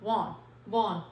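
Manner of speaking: "Want" said as "wan", no t; t dropped. Shown as a demonstration. Teacher answers her own question for students, t dropped. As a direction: clip the t off "Want". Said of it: "तो हमारा क्या होगा?".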